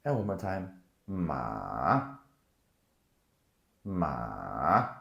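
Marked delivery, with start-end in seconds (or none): 1.1-2.1 drawn-out "Ma" dipping low, then rising; third tone, dipping. Shown as a demonstration. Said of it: "I'm overpronouncing it". 3.9-4.9 drawn-out "Ma" dipping low, then rising; third tone, dipping. Shown as a demonstration. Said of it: "I'm overpronouncing it".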